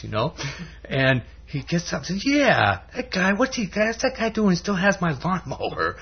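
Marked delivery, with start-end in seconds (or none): 2.3-6.0 high, agitated cartoon grumble; worked-up indignation. Pink Panther losing his peace over the lawnmower, indignant. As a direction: sound riled up, pitched way up. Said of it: "he begins to lose his peace".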